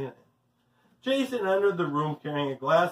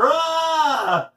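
put-on high, loud "Ah!" of a falling boy; startled yell; 10 semitones higher, 7 dB louder than usual. A narrator's reading. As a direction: cry out loudly as you topple. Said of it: "Jason yelled".

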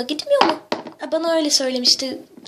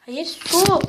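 normal talking voice

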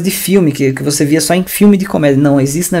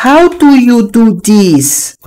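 very slow, higher mock Brazilian-accented English; bad English pronunciation; 7 semitones higher, 3 dB louder, much slower than usual. Mocking the teacher's English to students, exaggerated Brazilian accent. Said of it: "Desse jeito, eu juro".

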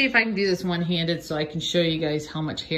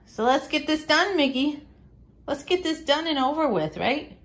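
coaxing pet talk; 8 semitones higher than usual. Real, not acted. Coaxing dog Mickey, higher voice.

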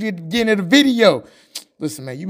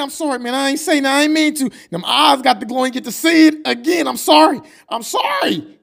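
scared pleading; 7 semitones higher than usual. Acted. Cowering, apologizing to a bully.

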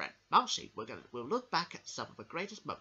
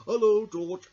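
loud hello; 4 semitones higher, 13 dB louder, slower than usual. Acted. Puppet greets loudly.

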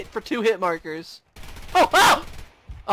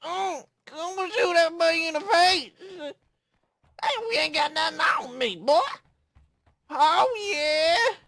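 put-on country accent; 12 semitones higher than usual. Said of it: "talking in a country accent".

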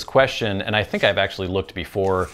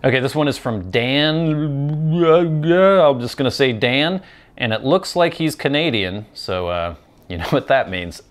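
normal talking voice

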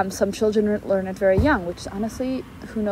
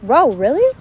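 loud, higher burst of disbelief; mock shock; 6 semitones higher, 9 dB louder than usual. Reacting to someone who doesn't know the book, shocked. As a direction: act taken aback and incredulous. Acts out shock at not knowing.

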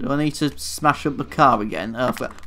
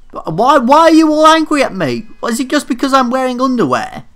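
indignant; 10 semitones higher, 6 dB louder than usual. Real, not acted. Everyone attacks him in underwear.

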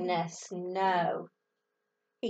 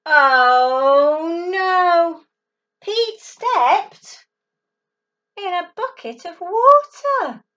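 louder and higher, alarmed; loud dramatic alarm; 4 semitones higher, 8 dB louder, slower than usual. Acted. Storyteller announces Pete stepping in the bucket, loud and alarmed. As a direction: make the kids gasp.

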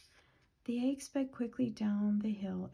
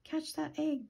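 higher-pitched mock yell; narrated yell; 6 semitones higher than usual. A narrator's reading. Animals yell to Pig about the egg, mild yell. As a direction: call out urgently at a moderate volume. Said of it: "they yelled to Pig".

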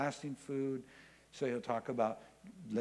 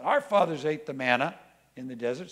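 loud retort; 8 dB louder than usual. Acted. Crowd throws back a retort.

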